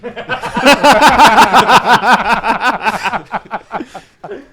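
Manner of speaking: big rising real laugh; loud burst of laughter. Real, not acted. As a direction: crack up loudly. Laughs at the car crash punchline.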